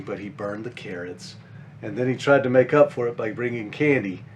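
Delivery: ordinary delivery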